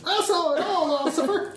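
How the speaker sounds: high, eager put-on witness voice; overenthusiastic witness. Player as witness stalling the police officer, overenthusiastic. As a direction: gush like a far too keen witness. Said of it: "be the overenthusiastic witness".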